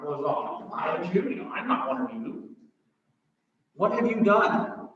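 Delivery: Pilate's reply. Acted. Voicing Pilate dismissively.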